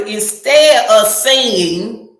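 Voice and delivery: ordinary delivery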